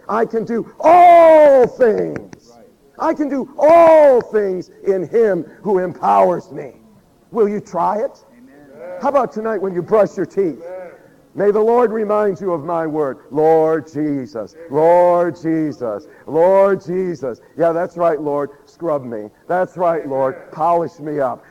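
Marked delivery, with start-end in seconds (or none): 0.1-6.7 loud, slow declaration of faith; proclaiming shout. Real, not acted. Preacher proclaims verse. 13.3-17.4 slow calling prayer. Shown as a demonstration. Demo of calling on the Lord.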